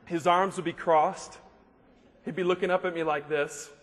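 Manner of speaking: usual speaking voice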